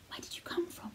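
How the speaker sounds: breathless unvoiced whisper; whispered question from a hidden sickly boy. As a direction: hush it like a frightened boy in the dark. Boy calls out at night.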